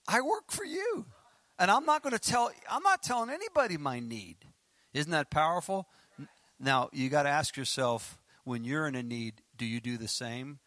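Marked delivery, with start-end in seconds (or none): 0.1-4.4 high, insistent plea to God; insistent declaration. Retold or imitated. Doctor telling God "I work for you", high-voiced insistence. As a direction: pitch jumps up in emphatic resolve. Acted defiant prayer to God.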